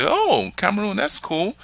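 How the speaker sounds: a light high voice for someone easily impressed; imitating the listener.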